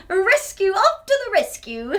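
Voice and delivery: loud, high heroic cry; superhero call. Pretend. Playing superhero for the kids, loud heroic shout. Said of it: "Can you pretend that you're a superhero?".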